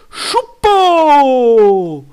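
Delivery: long drawn-out, high falling yell; excited shout. Real, not acted. Streamer reacting to a boost card, excited shout. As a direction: belt out the name, stretching the last vowel.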